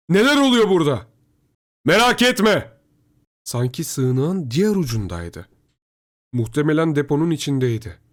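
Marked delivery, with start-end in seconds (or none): 0.1-2.8 high, loud long-distance shout; calling out. A narrator's reading. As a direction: shout so it carries across the shelter. Shouted exchange across the shelter. (yüksek sesle, uzağa bağırarak)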